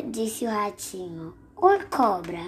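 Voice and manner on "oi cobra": plain reading voice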